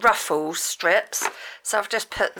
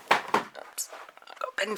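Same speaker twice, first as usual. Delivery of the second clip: whispered oops; 10 dB quieter than usual. Real, not acted. Dropped something, quiet aside.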